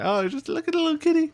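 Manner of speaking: high, quick, put-on coo; cooing at kitty. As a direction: gush over a cute little cat. Imitating neighbours cooing at cats.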